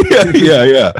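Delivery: high laugh into yeahs; laughing along. Real, not acted. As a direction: laugh and agree.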